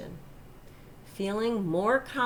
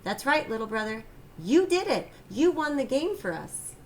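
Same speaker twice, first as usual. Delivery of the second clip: cheering brother; 6 semitones higher, 3 dB louder than usual. A narrator's reading. Brother cheers the winning shot.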